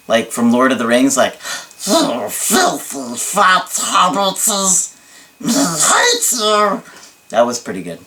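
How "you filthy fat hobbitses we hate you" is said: very slow, hissing creature voice, pitched higher; Smeagol voice. Acted. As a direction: hiss it out like Smeagol. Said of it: "I could do, like, Smeagol".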